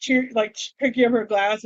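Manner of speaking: ordinary talk, nothing marked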